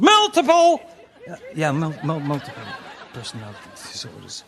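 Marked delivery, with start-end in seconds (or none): 0.0-0.8 shouted correction. Acted. Mocking correction.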